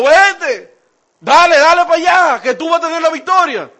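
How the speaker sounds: loud, high mock encouragement; ironic shout. Shown as a demonstration. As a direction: shout it so he hears you mean the opposite. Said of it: "por la forma ya te está dejando ver". (grito irónico y burlón)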